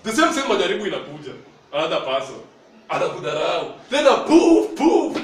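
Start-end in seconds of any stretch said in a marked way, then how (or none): 3.9-5.2 sudden high loud poof; mock explosion. Preacher acting out a believer who blows up at an insult, loud high poof. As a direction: burst out like something blowing up.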